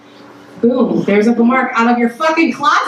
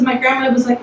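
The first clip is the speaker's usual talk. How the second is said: usual speaking voice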